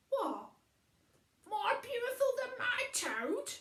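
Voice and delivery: very high, loud disbelieving question; incredulous call. Acted. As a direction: shout it in utter disbelief. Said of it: "called Billy".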